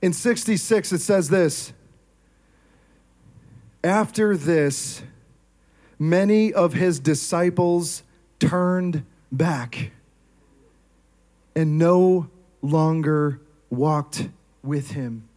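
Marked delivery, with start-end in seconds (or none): 3.8-15.3 slow, measured reading of the verse; unhurried scripture reading. Acted. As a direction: read the passage slowly and gravely. Reads scripture slowly.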